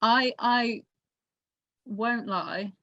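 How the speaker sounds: ordinary talk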